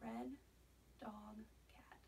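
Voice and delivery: soft whisper for a hearing test. Shown as a demonstration. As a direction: whisper each word barely audible. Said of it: "I'm gonna whisper to you".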